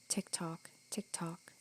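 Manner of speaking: soft unvoiced whisper; whispered tick-tock. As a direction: whisper each tick like the clock. Clock counting between apologies.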